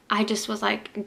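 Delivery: ordinary delivery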